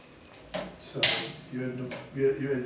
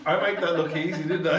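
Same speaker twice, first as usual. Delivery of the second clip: laughing; 3 semitones higher, 5 dB louder, faster than usual. Real, not acted. Laughing at own messy mixing.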